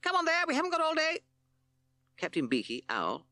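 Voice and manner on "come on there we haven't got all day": chirpy sparrow, much higher and fast. Acted. P C Sparrow hurries the band in, high and fast. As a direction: hurry them along in a high chirp.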